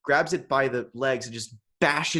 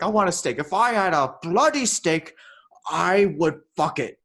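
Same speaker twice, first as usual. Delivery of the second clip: put-on loud, raised, overblown drunken declaration; piss drunk; 5 semitones higher, 6 dB louder than usual. Drunk keeper raving about steak, mock drunken rant. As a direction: rant with drunken conviction, drawing out the ending. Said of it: "he's piss drunk".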